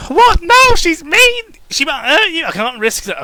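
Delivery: shrill, loud panicked shout, very high; yelling out. Acted. Pinkie yelling a frightened refusal to her boyfriend. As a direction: cry out in alarm. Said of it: "she yells out".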